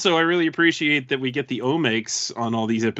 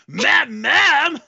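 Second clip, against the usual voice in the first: excited exclamation; 11 semitones higher, 5 dB louder than usual. Real, not acted. Punchline exclamation.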